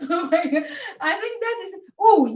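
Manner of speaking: giggling through the words, pitched high; high, amused giggle in the voice; laughing. Real, not acted. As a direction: laugh while doubting yourself. Giggles over unsure answer.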